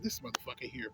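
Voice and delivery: low, hushed, exasperated grumble to oneself; angry inner aside. Retold or imitated. Applicant privately judging the interviewer, quiet annoyed aside. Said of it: "that's the shit that makes me an-, angry inside".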